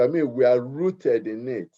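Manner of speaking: usual speaking voice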